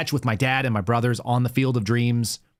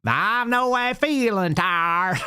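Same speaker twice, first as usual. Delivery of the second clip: high, slow put-on preacher drawl; mocking a politician's fake folksy sermon voice; fake Hillary Clinton; 9 semitones higher, much slower than usual. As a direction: stretch it out high like a revival singer. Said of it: "talks like fake Hillary Clinton".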